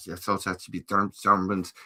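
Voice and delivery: ordinary talk, nothing marked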